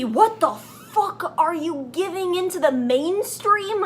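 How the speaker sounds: usual speaking voice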